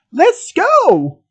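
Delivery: high, urgent call to run; sudden high-pitched exclamation; urgent cry. Acted. Friend breaks silence to flee.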